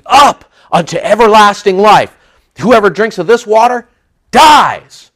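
high, loud building proclamation; preaching force. Acted. Preacher proclaiming Jesus' words, rising loud. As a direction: drive the point home. Preaching climax on living water.

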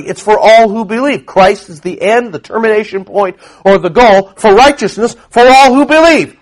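loud, high preaching voice; preaching at full force. Acted. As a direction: drive the point home from the pulpit.

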